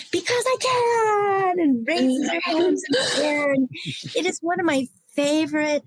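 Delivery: crying as she quotes her mother. Real, not acted. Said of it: "I don't mean to get emotional".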